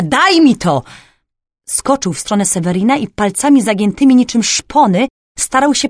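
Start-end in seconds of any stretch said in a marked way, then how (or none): 0.0-0.8 loud, grasping cry; greedy yell. A narrator's reading. Thief lunging at Sewerin for the flask, greedy shout. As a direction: demand it with raw craving. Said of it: "był cały ocean pożądania".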